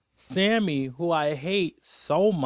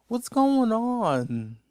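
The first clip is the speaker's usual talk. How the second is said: higher, slow, drawn out in disbelief; exasperated drawn-out question; 7 semitones higher, much slower than usual. Real, not acted. Reacting to Debbie's choices, slow high disbelief. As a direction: stretch it out like you can't believe it.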